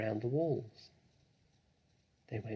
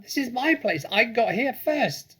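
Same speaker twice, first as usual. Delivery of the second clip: very high, loud shriek; shrieking rat; 13 semitones higher, 8 dB louder than usual. A narrator's reading. Rat shrieks at the fox to go away. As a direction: screech it like a furious rat. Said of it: "shrieked Rat".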